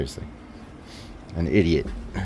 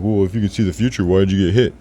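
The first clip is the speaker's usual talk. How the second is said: loud, fast, higher mock challenge; mocking a doubter; 4 semitones higher, 8 dB louder, faster than usual. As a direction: mimic a sceptic pouncing with a question. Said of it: "and you're like".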